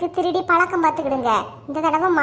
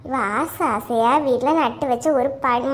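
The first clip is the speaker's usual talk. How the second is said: ordinary delivery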